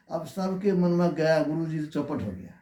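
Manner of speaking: ordinary talk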